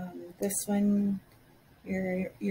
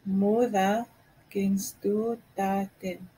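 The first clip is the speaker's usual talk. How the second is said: a slow careful model take; slow, in two parts; slower than usual. Shown as a demonstration. Teacher slowly repeating the phrase for learners. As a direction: pause after Moeder then say kennst du Taten slowly. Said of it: "I don't understand you".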